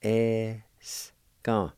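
Es-ka in two drawn-out syllables. Shown as a demonstration. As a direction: stretch Es, pause, then ka. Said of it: "First part is pronounced like this".

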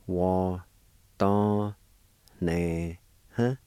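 "Wa, don, ne, huh" with a pause after each syllable. Shown as a demonstration. Teacher showing learners the word handing, syllable by syllable. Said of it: "It's pronounced like this".

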